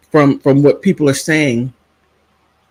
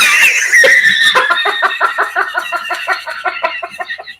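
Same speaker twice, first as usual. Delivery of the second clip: long, high cackle tailing off; uproarious laughter. Real, not acted. Daughter floored by her mother's retort, cackling. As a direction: laugh till the breath runs out.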